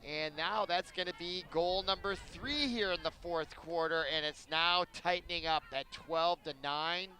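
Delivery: ordinary talk, nothing marked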